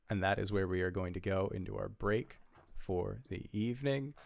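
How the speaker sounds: ordinary talk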